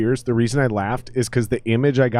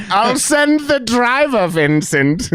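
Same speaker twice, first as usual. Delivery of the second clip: slow, higher English lady; creepy British woman; 6 semitones higher, slower than usual. Acted. Lady arranging Vincent's pickup, creepy British woman. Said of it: "just a creepy British woman".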